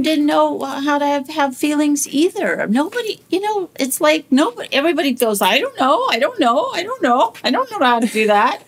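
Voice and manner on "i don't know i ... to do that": high, loud, repeated helpless plea; mock helplessness. Everybody goes clueless about handling feelings, mock helpless outburst. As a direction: throw it out like someone totally at a loss.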